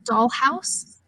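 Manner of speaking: ordinary delivery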